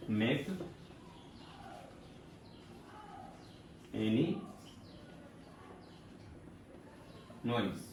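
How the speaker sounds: soft, spaced-out words; soft word-by-word dictation; slow dictation. Shown as a demonstration. Teacher dictating to class.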